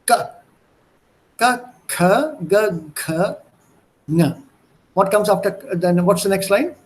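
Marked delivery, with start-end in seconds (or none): none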